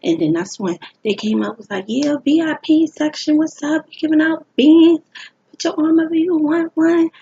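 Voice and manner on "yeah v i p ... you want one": high and loud hype-man call; promoter calling out. Retold or imitated. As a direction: shout the offer to the crowd like a promoter.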